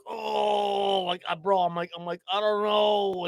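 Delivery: lightly acted high groan. Drunk, dazed reply after the fall.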